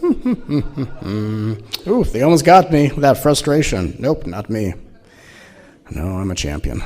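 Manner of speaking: mock-proud sing-song voice, lightly put on; smug spiritual champion. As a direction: parody a self-satisfied guru patting himself on the back. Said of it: "I'm not sitting here going".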